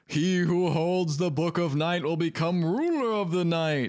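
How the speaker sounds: hammed-up dark sorcerer reciting his plan; villainous uncle. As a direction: intone a hammy prophecy, ending in a staged evil laugh. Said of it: "cut to the uncle who's like".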